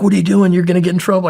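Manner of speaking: panicked warning to stop; alarmed. Retold or imitated. Mark panicking as his teammate breaks the zip ties, alarmed.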